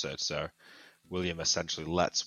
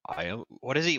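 ordinary delivery

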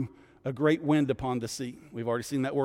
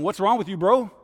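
higher, louder frantic shouting; panicked captain yelling; 6 semitones higher, 6 dB louder than usual. Retold or imitated. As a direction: shake a sleeping man awake in a storm.

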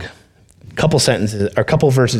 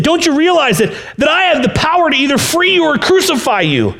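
high, loud shout of power; Pilate shouting; 4 dB louder than usual. Acted. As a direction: bellow the threat down at the prisoner. Pilate threatening Jesus.

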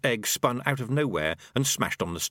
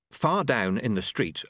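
plain reading voice

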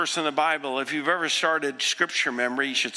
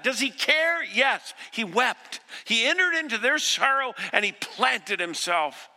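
full-force preaching; 6 semitones higher than usual. Acted. Pastor urging congregation, full-force preaching. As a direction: preach it out to the back row.

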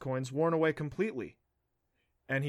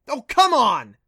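loud, high, exasperated yell; boy shouting in frustration; 11 semitones higher, 15 dB louder, slower than usual. A narrator's reading. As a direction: throw it out at full volume, fed up. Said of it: "he yelled".

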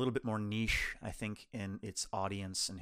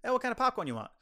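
popcorn seller; 11 semitones higher, 4 dB louder, faster than usual. Retold or imitated. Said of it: "And he goes".

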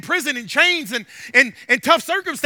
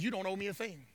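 low, soft, offhand answer; quiet retold reply; 6 semitones lower, 18 dB quieter than usual. Mechanic turning down payment from the stranded man, quietly. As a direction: say it low and soft, waving it away.

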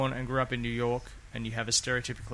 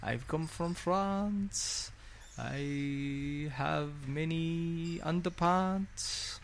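put-on very slow, higher, hammy French accent; hammed-up French accent; 5 semitones higher, 4 dB quieter, much slower than usual. Joking with co-host about accent syndrome, hammy French accent. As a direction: drag it out like a cartoon Frenchman. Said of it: "My French accent's really bad today".

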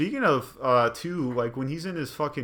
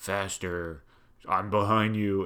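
weird ominous game voice; 3 semitones lower, slower than usual. Retold or imitated. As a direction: murmur it low and menacing from the shadows. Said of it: "this weird ominous voice".

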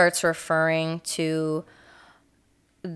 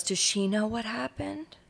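small, higher and much softer, timid; meek little voice; 4 semitones higher, 9 dB quieter than usual. Retold or imitated. Randy timidly asking the cops, in a small soft voice. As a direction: ask it like a frightened child. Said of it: "poor little Randy".

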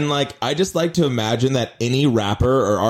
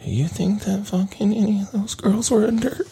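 high-pitched worried question; hammed-up anxious voice rising high; mock nervous; 10 semitones higher than usual. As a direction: play a sweating celebrity checking with a friend. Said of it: "People are sweating right now".

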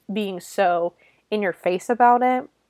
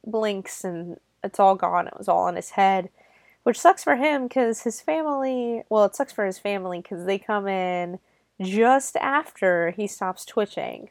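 ordinary talk, nothing marked

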